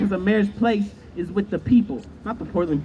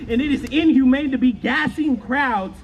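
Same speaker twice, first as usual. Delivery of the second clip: rising furious shout at the crowd; outraged shout; 6 semitones higher than usual. Real, not acted. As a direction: voice shooting up into a shout on pandemic. Angry protest speech about tear gas.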